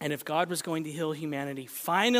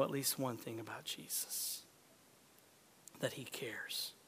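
whisper; 8 semitones lower, 15 dB quieter than usual. Real, not acted. Sermon point whispered.

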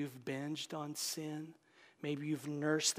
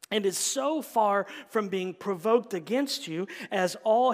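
louder, raised reading of the Puritan quote; reading Goodwin; 6 dB louder than usual. Acted. Preacher reading Puritan Thomas Goodwin to the congregation, louder proclamation. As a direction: proclaim the old text with conviction. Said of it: "He says".

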